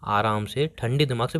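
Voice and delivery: calm placating. Acted. Boyfriend asks angry girlfriend to listen coolly, soft and placating.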